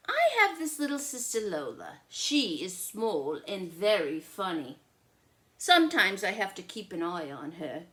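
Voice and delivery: bright storybook reading; story narration. A narrator's reading. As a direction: read it out like a picture book to a child. Reads the child narrator.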